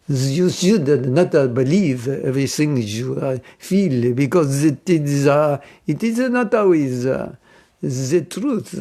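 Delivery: slow French accent, halting between phrases. Shown as a demonstration. Telling French people not to trust feelings, French accent. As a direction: drag it out like a French speaker searching for words. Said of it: "I like the French accent".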